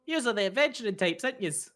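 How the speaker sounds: high Irish shopkeeper lilt; cheeky young Irish voice; Irish twang. Acted. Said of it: "just is like".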